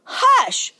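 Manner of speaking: quick high-pitched hush; called-out hush. A narrator's reading. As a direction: call for silence like an usher. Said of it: "And the usher called".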